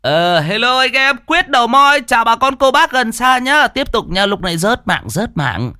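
high, hyped-up livestreamer patter; bubbling high-pitched live broadcast; loud livestream babble. A narrator's reading. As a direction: greet the viewers like an excitable streamer. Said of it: "dí cái smartphone mà bô bô".

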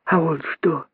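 put-on high, soft child's question; tiny high-pitched youngster; little monkey daughter. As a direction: ask in a small curious voice. Daughter monkey questions her mother.

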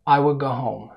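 "I will go home" at everyday pace, L of will dropped; natural speed. Shown as a demonstration. Teacher shows learners the middle, natural speed. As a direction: run "I will" together at normal speed. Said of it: "السرعة الطبيعية".

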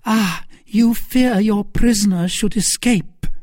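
high, loud mocking retort; mocking Milady. A narrator's reading. Milady mocking Lord de Winter, high and scornful. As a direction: taunt the baron.

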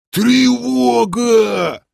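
high, long-drawn alarm cry; strained alarm yell. A narrator's reading. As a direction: wail the alarm with the word stretched right out. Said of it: "надрывно завопили откуда-то снизу". (надрывный протяжный вопль тревоги)

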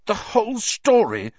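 high, loud incredulous outrage; furious. A narrator's reading. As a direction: fling the words back angrily. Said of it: "a furious pounding in his ears".

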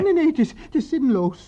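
loud, high despairing protest; defeated player protesting that it's pointless. Acted. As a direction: cry out that it's hopeless.

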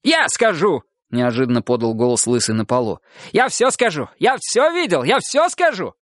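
high, frantic pleading; frantic blurting. Acted. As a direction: blurt it out desperately. Bald man begging to talk.

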